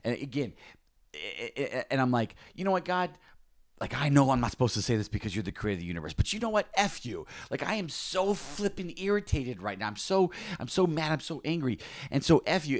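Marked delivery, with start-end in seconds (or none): 2.5-12.8 retold rant to God, pitched much higher; mock angry outburst. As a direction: vent your irritation at God, half-playing it. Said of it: "literally cussing God out".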